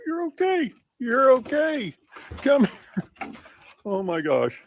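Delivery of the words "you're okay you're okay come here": high soothing dog talk. Real, not acted.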